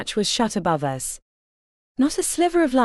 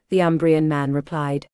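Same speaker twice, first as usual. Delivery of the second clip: ordinary delivery, nothing marked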